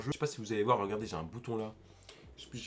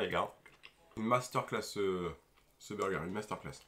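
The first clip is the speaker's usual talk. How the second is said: chewing while talking; words muffled by food; mouth full. Real, not acted. Seen 1: holds bitten burger, cheeks and lips show chewing between words.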